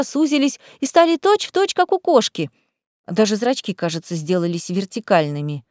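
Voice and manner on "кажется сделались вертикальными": ordinary delivery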